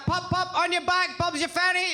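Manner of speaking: high, bouncy British mum babble; English mother's accent. Retold or imitated. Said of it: "mother never really adopted American English".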